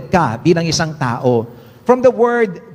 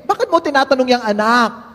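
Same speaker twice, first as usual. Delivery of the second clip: mother's voice; 7 semitones higher than usual. Acted. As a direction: ham up a mother who protests too much. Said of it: "Sumagot ngayon ang nanay".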